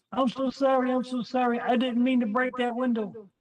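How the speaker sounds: mock the neighbor's weepy sorry; imitating her crying. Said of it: "and she's crying".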